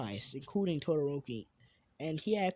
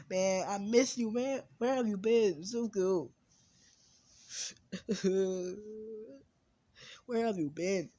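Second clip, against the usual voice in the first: mock crying; 6 semitones higher, 5 dB louder than usual. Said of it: "he was crying".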